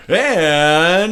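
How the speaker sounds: high, loud put-on announcer voice; full announcer voice. Mimicking the announcer after chatting, full voice. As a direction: imitate an announcer switching on full voice. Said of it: "he brings out the full voice".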